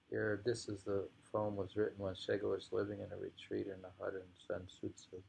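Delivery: ordinary talk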